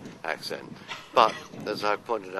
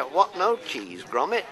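slow, higher, eager Wallace catchphrase; 8 semitones higher, 3 dB louder than usual. Shown as a demonstration. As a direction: stretch out the question, as Wallace asking his dog. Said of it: "I'm always being asked to say it".

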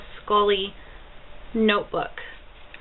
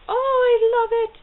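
much higher, loud and slow squeal of delight; 13 semitones higher, 7 dB louder than usual. Real, not acted. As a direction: squeal like a thrilled fan. Squealing over compact.

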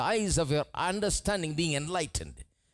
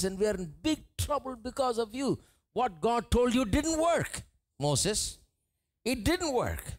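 complaining people; 7 semitones higher than usual. Acted. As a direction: whine the people's grievance at Moses. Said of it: "They said".

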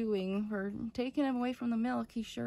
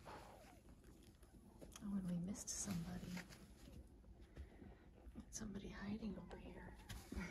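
very soft, low whispering; hushed discovery; 4 semitones lower, 15 dB quieter than usual. Real, not acted. Owner spotting a puppy hiding over here, hushed. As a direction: whisper so as not to disturb.

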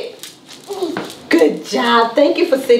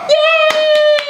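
excited cheer; 14 semitones higher than usual. Real, not acted. Praising child's correct placement.

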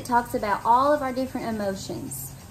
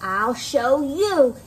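slow, louder put-on voice; grouchy ladybug; 3 semitones higher, 4 dB louder, much slower than usual. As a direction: drag it out as a threat.

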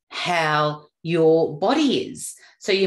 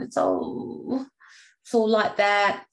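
mock sick drag; 3 semitones higher, slower than usual. Shown as a demonstration. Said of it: "talking like this".

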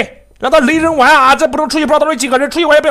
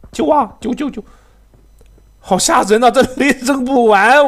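usual speaking voice

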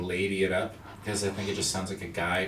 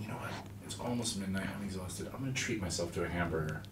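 hushed; 4 semitones lower, 12 dB quieter than usual. Retold or imitated. Retold tired midnight thought.

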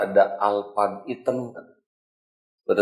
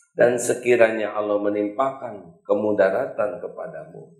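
ordinary talk

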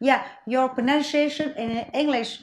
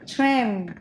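usual speaking voice

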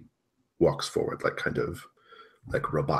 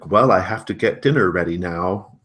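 artificial motherly cheer; 3 semitones higher, 7 dB louder than usual. Acted. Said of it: "and she turns".

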